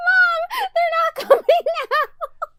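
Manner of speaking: high, loud wail through laughter; drawn-out, laughing fake cry; mock sobbing. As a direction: imitate sobbing to mom, cracking up. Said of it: "I call my mom sobbing".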